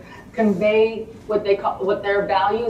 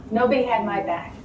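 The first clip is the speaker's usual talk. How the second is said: normal talking voice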